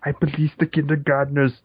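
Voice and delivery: hammed-up Austrian action hero; Arnold Schwarzenegger. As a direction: declare it like a muscleman star. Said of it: "random Arnold Schwarzenegger movies".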